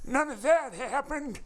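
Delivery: put-on very high, slow old-timer voice; hammed-up high elderly voice; mock old-timer. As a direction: grumble like a cartoon geezer reminiscing. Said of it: "Showing Your Age Via References Hour".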